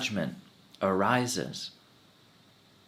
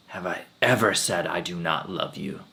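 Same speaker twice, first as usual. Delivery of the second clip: ordinary talk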